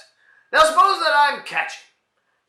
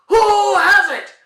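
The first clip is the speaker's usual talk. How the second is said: high, loud, slow demand; exasperated demand; 10 semitones higher, 7 dB louder, much slower than usual. Acted. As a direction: drag out the question in frustration. Exasperated demand in comedy routine.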